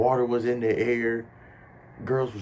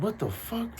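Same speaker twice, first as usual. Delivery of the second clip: shocked whisper-like; 13 semitones higher, 7 dB quieter than usual. Retold or imitated. Seeing people doing a line.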